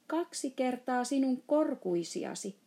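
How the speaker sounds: put-on astonished girl exclaiming loudly; shouting. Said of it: "huusi Sohvi".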